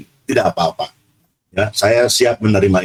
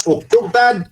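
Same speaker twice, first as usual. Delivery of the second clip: "Uktub, Tad?" as a pupil's raised-pitch question; questioning intonation; 8 semitones higher, slower than usual. Acted. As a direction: ask "Uktub, Tad?" from the back of the class. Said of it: "dari belakang nanya".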